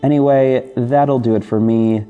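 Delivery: normal talking voice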